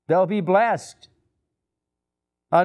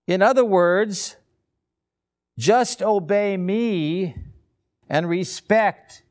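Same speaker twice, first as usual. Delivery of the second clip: usual speaking voice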